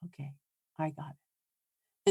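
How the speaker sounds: soft, slow, low-key "aha, okay"; husband getting the hint. Retold or imitated. Husband answering wife's knee tap, soft and slow. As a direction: ease off and give a drawn-out, soft acknowledgement. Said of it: "and he goes".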